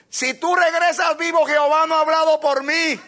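high, loud prophetic shout; shouted warning. Retold or imitated. Prophet shouting a warning at the departing king. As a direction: call it out after the king. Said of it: "le voció y le dijo".

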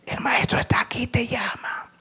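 hushed, voiceless whisper; softly whispered message; secret whisper. Acted. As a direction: whisper it like a secret passed to a sister. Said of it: "diciéndole en secreto".

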